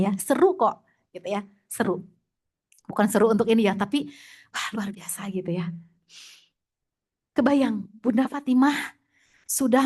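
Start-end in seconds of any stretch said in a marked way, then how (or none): 4.5-5.7 whispered wonder; a hushed amazed aside; awed whisper. Real, not acted. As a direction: breathe it out in awe. Expressing awe.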